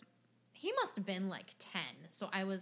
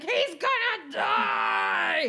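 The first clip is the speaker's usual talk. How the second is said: drawn-out, high panicked shout; mock hysterical shout; dramatic panic; 7 semitones higher, 10 dB louder, much slower than usual. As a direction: yell it like an overdramatic dad. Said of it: "He's just dramatic".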